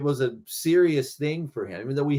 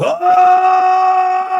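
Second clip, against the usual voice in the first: very high, loud sustained battle cry; Rambo scream; 12 dB louder than usual. Shown as a demonstration. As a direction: let out a long war howl. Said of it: "doing the Rambo".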